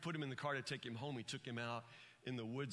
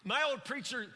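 high, driving pulpit delivery; full-force preaching; 10 semitones higher, 8 dB louder than usual. Real, not acted. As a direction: preach it with rising fervour and punched words.

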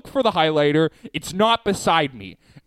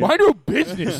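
high, louder mock retort; mock snap; 10 semitones higher, 4 dB louder than usual. Joining the bit against the noisy friend, a loud high retort. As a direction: bark it back at the friend.

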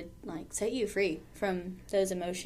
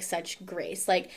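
ordinary talk

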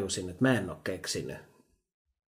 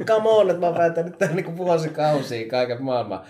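mock indignation; 2 semitones higher, 6 dB louder than usual. Mum answering child who praised dad's omelette, mock-indignant protest. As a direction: protest through amusement, easing off toward the end. Mother's indignant reply, voiced in story.

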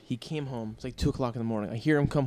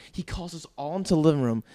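ordinary talk, nothing marked

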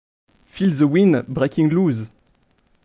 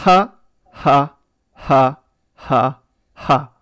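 loud, evenly spaced spoken "ha"; deadpan lyric reading; 6 dB louder, much slower than usual. Pretend. Quizmaster reads song lyrics for contestants to guess, deadpan. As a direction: read out sung ad-libs as separate monotone syllables. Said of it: "vous les lire de manière un peu monotone".

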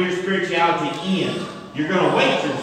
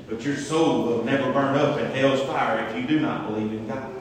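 ordinary delivery

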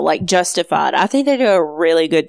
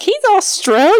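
much higher, surprised question; shocked disbelief; 13 semitones higher, 3 dB louder than usual. Retold or imitated. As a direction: let it jump up in disbelief. Shocked learning actor's nationality.

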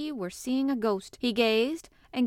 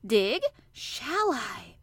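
surprised; 3 dB louder, much slower than usual. Acted. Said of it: "Colin flushed tremendously".

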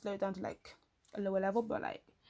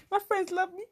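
laughing; 10 semitones higher than usual. Retold or imitated. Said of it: "I'm like".

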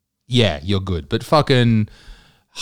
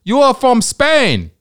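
much higher, slow and over-eager foreign voice; party official impression; 12 semitones higher, 3 dB louder, much slower than usual. Party official calls him up, high eager accented voice. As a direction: play the eager official on the phone. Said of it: "he's like".

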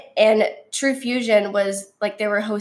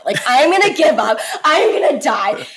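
louder, higher hammed-up wail; mock despair; 4 semitones higher, 5 dB louder than usual. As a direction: playfully exaggerate your exhausted defeat. Retold despair during hard exercise.

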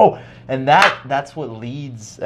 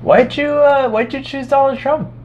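much higher, foreign-sounding put-on monk voice; Thai monk voice. A monk answers the student's "America," high and eager. Monk asks about Trump.

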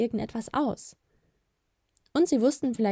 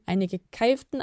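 ordinary delivery

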